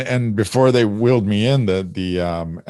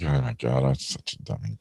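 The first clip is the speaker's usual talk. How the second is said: sheepish mumble; 6 semitones lower, 10 dB quieter than usual. Retold or imitated. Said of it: "I was just like".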